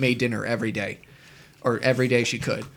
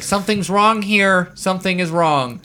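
voicing stomach; 6 semitones higher, 4 dB louder than usual. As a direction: play the worried stomach. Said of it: "my lactose is like".

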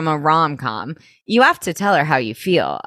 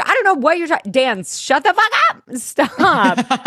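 higher, mock-frantic telling off; exasperated outburst; 8 semitones higher than usual. [name] cutting Dan off, high and exasperated. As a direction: shout him down in a mock panic.

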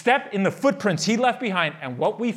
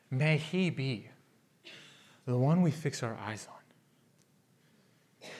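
soft benediction; 8 semitones lower, 11 dB quieter than usual. Acted. Pastor closing the sermon, hushed and gentle. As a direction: lay the blessing softly over them.

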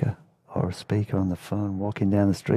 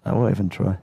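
plain reading voice

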